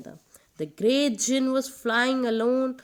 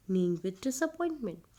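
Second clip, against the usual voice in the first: ordinary delivery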